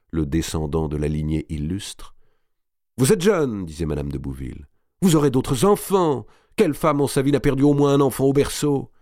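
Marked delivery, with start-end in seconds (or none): 3.0-3.6 plaidoyer plus aigu, insistant; argumentation pressante. A narrator's reading. Madame de Bouville raisonne Marie. 5.0-8.9 high, insistent pleading; pressing argument. A narrator's reading. Madame de Bouville raisonne Marie.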